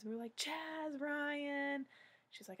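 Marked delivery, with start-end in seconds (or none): 0.4-1.8 names blurted out much higher; eager high answer. Retold or imitated. Fans to the lady, high and eager. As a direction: rattle them off, thrilled.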